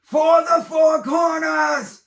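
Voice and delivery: a warrior's charging war cry; thunderous battle cry. A narrator's reading. Egan charging the spider horde, thunderous battle cry. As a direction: roar it out, high and slow, full force. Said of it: "lets out a thunderous battle cry".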